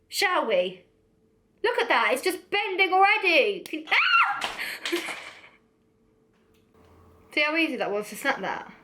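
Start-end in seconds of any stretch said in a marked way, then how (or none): 3.9-4.5 sudden very high shriek; startled scream. Real, not acted. Vlogger snapping the flimsy pencil on camera, startled scream. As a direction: yelp sharply in surprise. Pencil snaps while she bends it.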